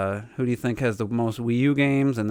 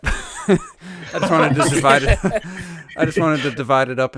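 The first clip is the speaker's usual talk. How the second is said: loud, laughing self-defence; words breaking up with laughter; 4 semitones higher, 7 dB louder than usual. Real, not acted. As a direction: protest through real laughter. Laughs while explaining poll options.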